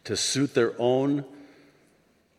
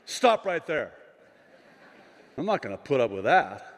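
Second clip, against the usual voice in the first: ordinary talk, nothing marked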